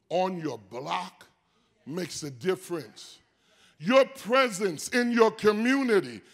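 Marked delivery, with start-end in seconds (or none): 3.8-6.2 loud, driving preacher's cadence; full-force preaching. Acted. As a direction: build it up and drive each phrase home to the congregation.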